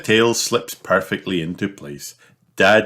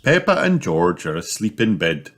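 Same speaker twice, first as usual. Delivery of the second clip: ordinary delivery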